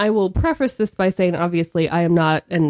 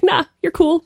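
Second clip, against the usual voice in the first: higher-pitched, offhand brush-off; flippant; 11 semitones higher, 4 dB louder than usual. Retold or imitated. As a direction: imitate a friend flippantly waving it off. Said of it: "his response was potentially flippant".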